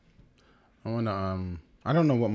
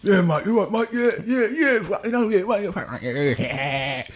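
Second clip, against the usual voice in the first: geezer impression; 8 semitones higher than usual. Comedian imitating the rowdy geezers at the Harlow gig. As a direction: mimic a Harlow lad nodding along.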